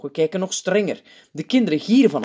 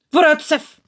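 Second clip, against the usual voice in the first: loud, high teacher's snap; sharp, impatient command; snapping; 12 semitones higher, 7 dB louder than usual. A narrator's reading. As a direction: snap the order at a pupil. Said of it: "snauwt de juf".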